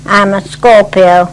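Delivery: ordinary talk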